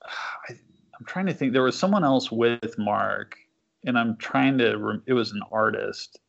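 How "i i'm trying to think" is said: muttered under the breath while thinking; unvoiced thinking-aloud whisper; whispered to self. Real, not acted. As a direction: whisper it to yourself, trying to recall. Thinking aloud, searching memory.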